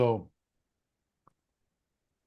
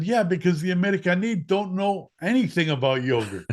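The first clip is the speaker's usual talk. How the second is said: higher and louder, keen to explain; eager kid; 8 semitones higher, 5 dB louder than usual. Retold or imitated. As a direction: sell the idea to dad.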